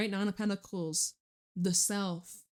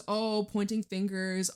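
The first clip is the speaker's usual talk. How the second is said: usual speaking voice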